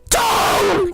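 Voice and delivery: clock cries out. A narrator's reading. The clock crying out at the stroke. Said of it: "cried the clock".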